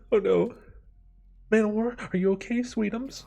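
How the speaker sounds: high-pitched mock-weeping mother; tearful mom. Mom fussing over her stung child, hammed-up tearful voice. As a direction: fuss over a child in an exaggerated tearful squeal. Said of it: "and she's in tears".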